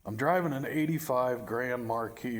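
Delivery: ordinary talk, nothing marked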